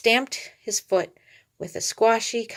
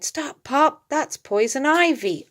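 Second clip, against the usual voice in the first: cried out; 7 semitones higher, 3 dB louder, slower than usual. A narrator's reading. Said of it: "he cried".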